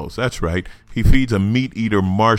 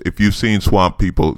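ordinary talk

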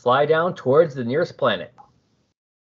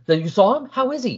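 excited; 10 semitones higher than usual. Retold or imitated. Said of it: "Stealth is all excited".

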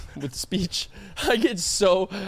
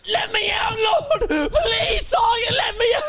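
trapped pet crying. Pretend. Pet trapped in owner's bed, high crying plea. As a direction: beg to be let out like a trapped pet. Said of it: "and I go".

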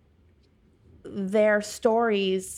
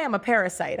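usual speaking voice

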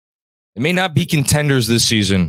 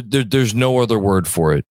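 ordinary talk, nothing marked

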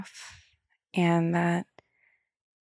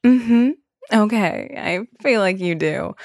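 knowing therapist; 5 semitones higher, 4 dB louder, slower than usual. Retold or imitated. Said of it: "she'll be like".

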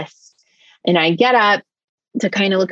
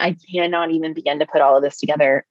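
ordinary talk

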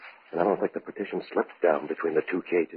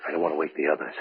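hushed; 5 semitones lower, 7 dB quieter than usual. Acted. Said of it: "Be quiet".

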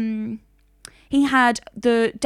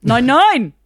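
mock German no; 3 semitones higher, 8 dB louder than usual. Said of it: "echoing the German mother".

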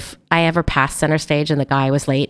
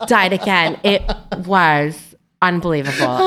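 ordinary talk

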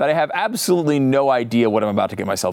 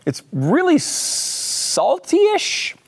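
surprised; 13 semitones higher, much slower than usual. Real, not acted. Tasting a weird lollipop.

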